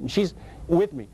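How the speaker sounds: ordinary delivery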